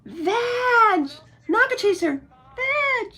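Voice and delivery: high, loud call of names. Real, not acted. Owner calling her pets by name, loud. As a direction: call the pets from across the room.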